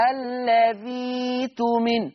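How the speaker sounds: "الذي تؤمن" with a long drawn ee, no glottal stop; hamza turned to yaa. Shown as a demonstration. Teacher showing Warsh's substitution, "الذي تؤمن" held long on ذي. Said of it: "هتبدل ياء".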